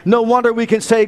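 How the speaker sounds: ordinary talk, nothing marked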